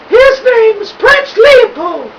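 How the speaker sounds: put-on very high, slow old woman's voice, calmly calling up; unperturbed old lady. A narrator's reading. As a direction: answer him sweetly, unbothered.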